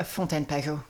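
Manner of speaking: "Fontaine Pajot" said the French way, nasal vowels, stress on the end; French brand name in native French; French pronunciation. Real, not acted. Host asks her to say it again, "Fontaine Pajot" in French. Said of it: "can we talk about how she says".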